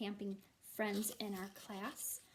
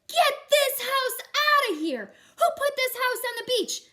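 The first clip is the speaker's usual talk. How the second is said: angry judge; 12 semitones higher, 14 dB louder than usual. Acted. Judge ordering the house off the beach, loud and angry. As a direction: boom it out like an outraged official.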